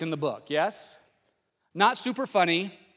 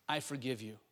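soft, low, hushed voice; gently forgive, well under your breath; quiet forgiveness; 5 semitones lower, 11 dB quieter than usual. Acted. Jesus on the cross to those crucifying him, quiet forgiveness.

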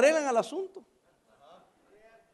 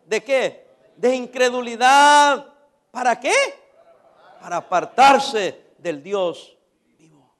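loud, slow, drawn-out declamation; preached scripture reading; 8 dB louder, much slower than usual. Acted. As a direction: stretch each phrase of the verse with force. Reads scripture to congregation. (lectura predicada, fuerte y pausada)

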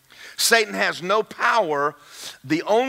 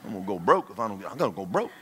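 low, very soft grumbling to yourself; under-the-breath fretting, low and quiet; muttered private worries; 11 semitones lower, 9 dB quieter, faster than usual. Acted. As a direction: mumble it like thoughts during church. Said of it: "some of you are already going".

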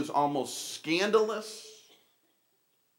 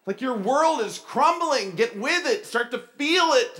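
high-pitched, urgent coaching; worked-up director's scolding; exasperated drama director; 8 semitones higher, 4 dB louder than usual. Retold or imitated. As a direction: imitate a director pushing an actor. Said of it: "He's like".

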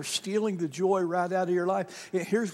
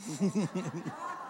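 soft laugh; 8 dB quieter than usual. Real, not acted. Pastor laughs at punchline.

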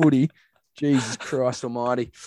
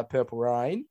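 usual speaking voice

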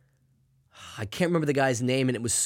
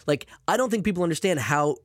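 usual speaking voice

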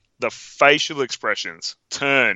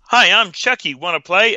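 higher, chirpy talking-doll voice; Chucky; 7 semitones higher than usual. Acted. Doll greets the mum as its head turns, toy voice. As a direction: imitate a toy doll's greeting. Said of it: "Chucky's head turns completely around and goes".

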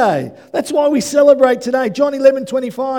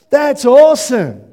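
excited exclaim; 3 semitones higher, 8 dB louder than usual. Real, not acted. Exclaims after the quote.

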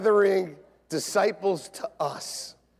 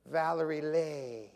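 slow, soft Southern drawl on the name; 5 dB quieter, slower than usual. Retold or imitated. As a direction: stretch the last vowel the Southern way. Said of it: "People from the South, they don't, when they talk".

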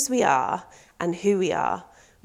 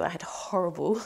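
whispered aside; 7 dB quieter than usual. Real, not acted. Self-mocking aside about fashion.

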